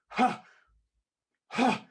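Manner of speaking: loud, high huffs of outrage; fuming huff. Retold or imitated. Blocked manager with no comeback, fuming. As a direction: puff like fumes are coming out. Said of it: "Fumes coming out his ears".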